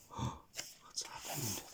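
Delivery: gasp, then a scared breathless whisper; frightened whisper. Acted. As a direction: whisper it, lost in the dark. Tom wakes in darkness, gasping.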